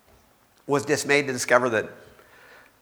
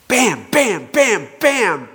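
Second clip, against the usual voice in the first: punching bams; 7 semitones higher, 5 dB louder, much slower than usual. Acted. Satan striking Job.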